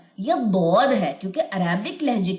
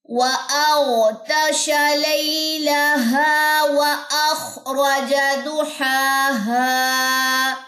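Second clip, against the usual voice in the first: Quran recitation; 5 semitones higher, much slower than usual. Acted. Teacher reciting the verse for students, slow and melodic. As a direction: recite the verse in a long, sung tune.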